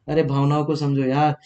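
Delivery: usual speaking voice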